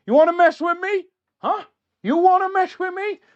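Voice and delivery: high, loud mock Italian tough guy; Italian accent. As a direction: challenge them in a hammed-up Italian accent. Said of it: "That was my Italian accent".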